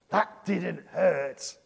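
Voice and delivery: slow defiant taunt; defiant child. Retold or imitated. Child defying a smack, slow taunting defiance. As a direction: dare them to try again. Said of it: "there would be real defiance".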